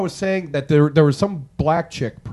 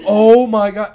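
loud, high mock-appalled cry; retold shock; 4 semitones higher, 11 dB louder, slower than usual. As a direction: replay your horrified reaction at full volume. Retold shock at racist remarks.

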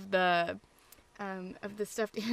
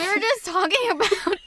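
high, loud, laughing confession; helpless laughing; 10 semitones higher, 6 dB louder, slower than usual. Real, not acted. Co-host confessing a long nineties-lunch phone call, helpless laughing. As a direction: crack up while getting the words out.

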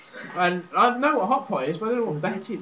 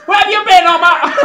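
high, loud northern English wife scolding; northern housewife; 12 semitones higher, 13 dB louder than usual. Acted. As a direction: shout it as a mock northern mum. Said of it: "And she's like".

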